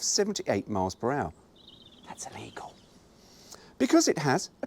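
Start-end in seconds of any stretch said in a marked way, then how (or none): 2.1-2.7 unvoiced, much softer jab; whispered aside. Real, not acted. Muttering to the listener about the cruising speed, whispered. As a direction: slip it in under the breath.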